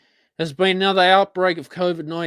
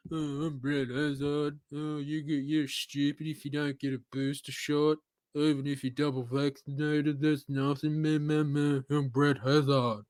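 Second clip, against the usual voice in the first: cranky old man, mocking and droning; soft, dreary caricature of a grumbling old man; Brad Hazzard; 4 semitones lower, 7 dB quieter than usual. Mocking health minister's booster warnings, cranky old man voice. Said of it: "cranky old man".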